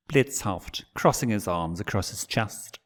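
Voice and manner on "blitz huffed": usual speaking voice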